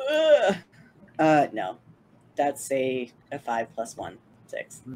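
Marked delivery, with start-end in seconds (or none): 0.1-0.5 high, loud ugh; frustrated groan. Real, not acted. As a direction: groan sharply at a bad roll. Groans at the dice roll.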